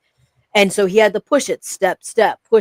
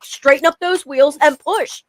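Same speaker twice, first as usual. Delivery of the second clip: firm coaching dad, pitched up; retold pep talk, higher and insistent; imitating tough dad; 6 semitones higher than usual. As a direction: urge him on like a demanding father. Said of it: "I'm hard on him".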